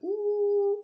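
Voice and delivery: very high, loud "ooh"; imitated "ooh". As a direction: let out a piercing, drawn-out mock "ooh". Imitating reaction to sour lemon.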